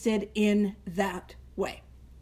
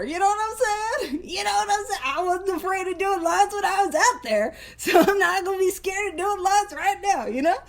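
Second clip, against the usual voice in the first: lightly voiced tough old self, higher; a hint of bravado, pitched up; mock old-timer voice; 7 semitones higher than usual. Talking to the group as her old drinking self, lightly put-on voice.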